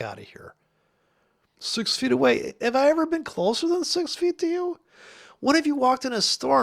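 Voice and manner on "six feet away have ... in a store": much higher, mocking squeal; mocking outrage. Real, not acted. Ranting about distancing rules, high sarcastic squeal. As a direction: throw it back in sarcastic outrage.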